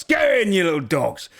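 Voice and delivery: sharp high shout at prisoners; guard barking orders. Acted. Guard shoving prisoners into cell.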